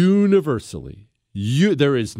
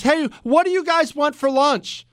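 clueless ship captain; 11 semitones higher than usual. Acted. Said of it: "ship captain who leans over to the mother and says".

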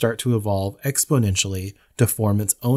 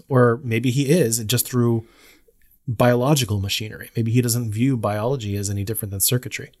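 normal talking voice

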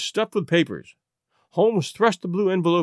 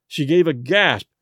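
ordinary delivery

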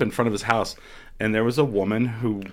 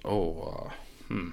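imitating an awkward, quiet hesitation; soft, uneasy stalling; hesitant; 4 semitones lower, 11 dB quieter, slower than usual. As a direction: trail off softly, reluctant to answer. Acting out the guest's awkward hesitation.

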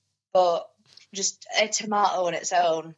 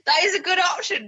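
ordinary delivery

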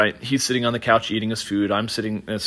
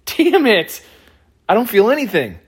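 higher and louder, a hammed-up groan of annoyance; mock frustrated outburst; 10 semitones higher, 5 dB louder than usual. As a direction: blurt it out like a cheated kid. Frustrated he still isn't high.

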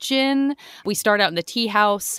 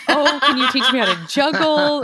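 eager laughing; 5 semitones higher, 4 dB louder than usual. Retold or imitated. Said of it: "She's like".

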